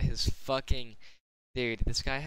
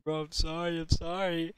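higher, whiny imitation of a sobbing kid; mock crying plea; 7 semitones higher than usual. Kid on his knees begging, mock sobbing. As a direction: lightly mimic a kid begging through tears. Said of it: "crying on his knees like".

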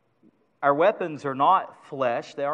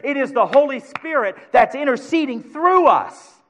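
loud, high, rising sermon cry; full-force preaching; 6 semitones higher, 6 dB louder than usual. Acted. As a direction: preach it out to the whole church.

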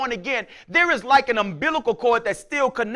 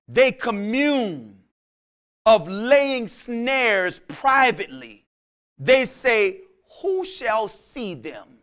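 slow scripture reading; slower than usual. Acted.

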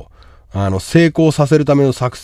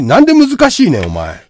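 high, loud protest; high-pitched indignant outburst; exasperated retort; 7 semitones higher, 6 dB louder than usual. Real, not acted. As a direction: throw the excuse back at them loudly. Retorting to viewer's excuse.

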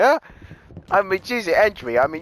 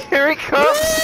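excited call; 8 semitones higher, much slower than usual. Real, not acted. Excited call as Entry approaches.

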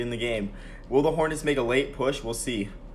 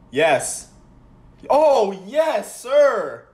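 high, loud cheering yell; excited yell; 12 semitones higher, 10 dB louder, much slower than usual. Real, not acted. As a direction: whoop it out in triumph. Big play goes his way.

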